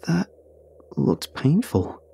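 slow, halting words with a gap; mildly faltering, haltingly slow; stuttered. A narrator's reading. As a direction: hesitate after the first word, then go slowly. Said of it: "I stuttered".

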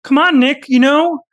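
high, strained Jordan Peterson voice; Jordan Peterson impression. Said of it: "does Jordan Peterson's voice not sound almost perfect?".

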